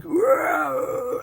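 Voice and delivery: mock bear growl, high and rough; imitating a bear roar. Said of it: "normally when bears are like".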